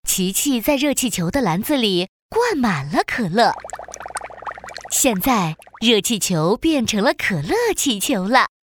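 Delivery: ordinary delivery, nothing marked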